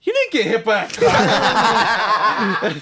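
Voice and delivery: high, slow taunting sneer; high sneering little-sister taunt; mocking sister. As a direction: drag it out to expose the lie. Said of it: "her hater ass like".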